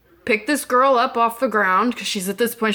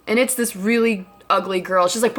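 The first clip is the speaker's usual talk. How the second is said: ordinary delivery, nothing marked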